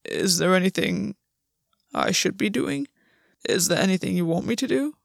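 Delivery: hesitant, puzzled question rising at the end; confused. A narrator's reading. Gwen asks August for instructions, confused. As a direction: ask uncertainly, with pauses.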